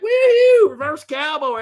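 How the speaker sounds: high loud whoop; whooping sister. Retold or imitated. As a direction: cheer it out wildly. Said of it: "My sister's like".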